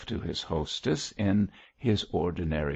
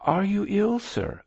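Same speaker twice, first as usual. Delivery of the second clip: high, concerned question; anxious care, starting high and falling away; solicitous; 14 semitones higher, 4 dB louder than usual. A narrator's reading. George asks after his father's health, with solicitude. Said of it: "with solicitude".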